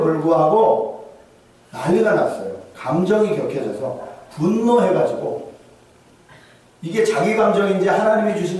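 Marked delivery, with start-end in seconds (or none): none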